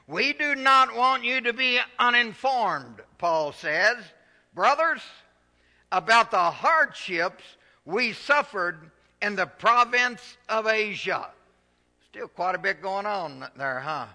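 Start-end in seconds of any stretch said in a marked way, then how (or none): 0.1-11.3 loud, high, rousing preacher's cry; full-force preaching. Acted. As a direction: lift the congregation, preaching hard. Preaching scripture aloud.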